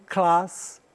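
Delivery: Class with a broad British a, like "klahs". Shown as a demonstration. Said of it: "um som diferente".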